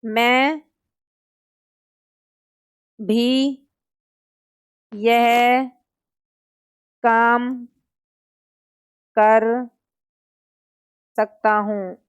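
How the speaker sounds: each word alone with long pauses, for copying; teacher dictating a sentence for students to write down slowly. Shown as a demonstration. Teacher dictates to students, word by word. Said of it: "example हो गया जैसे".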